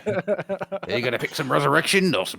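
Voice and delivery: a chirpy cockney Cenobite taunting. Pretend. Host plays a cockney Cenobite offering power, mock cockney. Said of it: "slightly cockney working class Cenobites".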